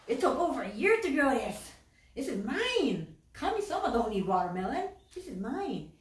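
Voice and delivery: acted greedy outburst; selfish and possessive. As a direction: protest like a greedy hoarder. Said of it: "they're selfish, they're like".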